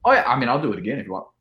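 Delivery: quick, eager offer; eager rush. Retold or imitated. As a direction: rattle it off keenly. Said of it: "So I was like".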